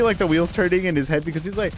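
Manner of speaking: ordinary talk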